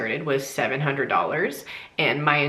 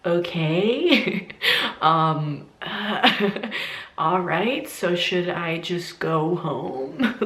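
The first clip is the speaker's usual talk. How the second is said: hesitant, halting, with small awkward laughs; unsure; 2 semitones higher, much slower than usual. Retold or imitated. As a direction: act out a confused patient, slowing into the question. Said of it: "I was so unsure".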